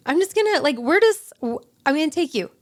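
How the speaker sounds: imitating an upbeat friend, high and loud. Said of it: "She's, like, upbeat".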